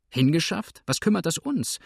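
high, incredulous scoff; scornful retort. Acted. Husband scoffs at wife's question, incredulous. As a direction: toss the word back at her.

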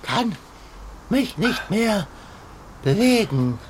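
weak, halting, worn-out voice; sore muscles. Acted. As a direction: drag each word out, exhausted. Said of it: "Die Hitze hat mich ausgemergelt". (erschöpft und stockend)

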